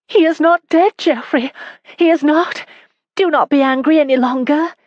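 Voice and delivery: a distraught wife sobbing out bad news; tearful young wife. A narrator's reading. Wife crying to her husband, high and tearful.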